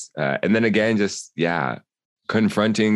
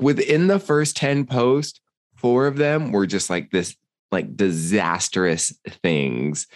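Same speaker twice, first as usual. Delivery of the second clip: ordinary talk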